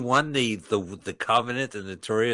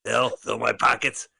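mock prank caller; 3 semitones higher, 4 dB louder than usual. Prank phone call gag.

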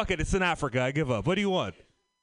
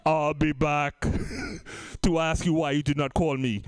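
Terminator voice; 6 semitones lower than usual. Acted. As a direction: drag it out low like the Terminator. Terminator quote, slow low voice.

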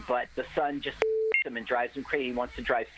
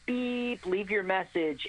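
answering machine voice; 7 semitones higher, slower than usual. Retold or imitated. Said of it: "the answering machine goes".

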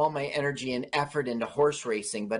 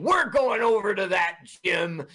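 mock shout; 6 semitones higher, 5 dB louder than usual. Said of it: "they're saying".

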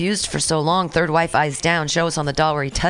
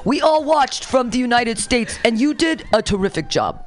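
higher-pitched mock presidential congratulations; reading as the president; 6 semitones higher than usual. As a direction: play a president heaping on praise. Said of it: "You'll know when it says the president".

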